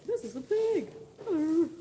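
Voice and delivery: high, swooping introduction; playful pet talk. Real, not acted. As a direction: pipe up brightly to show off an animal. Presenting and greeting the pig.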